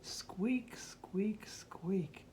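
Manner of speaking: soft secretive whispered squeaks. Acted. Reading to a child, whispering the mysterious little squeak. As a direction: hush it like a secret little noise.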